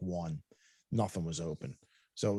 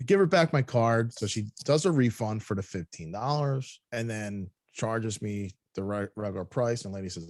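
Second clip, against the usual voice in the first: usual speaking voice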